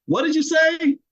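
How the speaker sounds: sharp high pretend deafness; mock pretend not hearing. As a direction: act like you never heard them. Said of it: "And they say".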